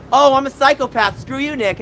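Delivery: high-pitched mocking quote; mock dismissive voice, starting loud; mocking imitation. Mimics Mark brushing off his lie.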